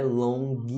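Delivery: 'long' as two beats, 'lon-ghee'; added G vowel. Shown as a demonstration. Teacher rejecting a mistake, added G vowel. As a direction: wrongly finish 'long' with hard G and ee. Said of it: "Não é long".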